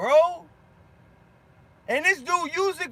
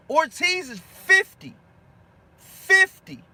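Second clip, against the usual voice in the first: "Fifty" shouted high and loud; incredulous outburst; 6 semitones higher, 5 dB louder, slower than usual. Real, not acted. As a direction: hammer home "Fifty" in disbelief, louder and higher. Incredulous at chat's boxing pick.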